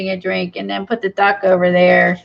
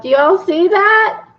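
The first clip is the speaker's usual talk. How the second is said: high, loud, drawn-out excited question; 10 semitones higher, 5 dB louder than usual. Real, not acted. Crafter showing viewers her little pond, excited. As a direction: let delight push the question up high.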